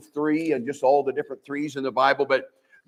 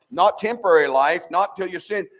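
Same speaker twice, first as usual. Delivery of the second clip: ordinary talk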